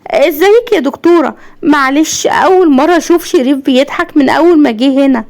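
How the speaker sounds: high-pitched, excited motherly greeting; delighted mother. Acted. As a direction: gush happily to the doctor, pitch lifted. Mother happily greets the doctor.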